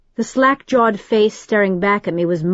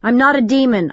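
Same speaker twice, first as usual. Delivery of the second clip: firm, raised defiant denial; bold, louder protest; 5 semitones higher, 4 dB louder, faster than usual. A narrator's reading. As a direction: stand your ground, louder. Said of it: "I said boldly".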